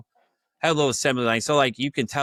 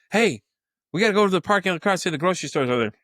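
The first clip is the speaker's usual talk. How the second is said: put-on higher, louder, rushed voice of the girl; frantic hallucinating girl; 7 semitones higher, 4 dB louder, faster than usual. The girl urgently tells the boss about the birds, higher and louder. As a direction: sound urgently sure the birds spoke.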